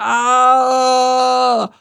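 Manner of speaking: high, sustained yell while plummeting; loud drawn-out cry of alarm; long falling scream. A narrator's reading. Mike falls mid-word.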